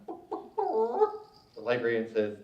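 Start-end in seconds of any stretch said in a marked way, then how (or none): 0.0-1.3 soft clucks rising to a high squawk; clucking chicken. Acted. Chicken answers the librarian, high soft clucking. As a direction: cluck like a hen, stretch the last one. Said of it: "The chicken says".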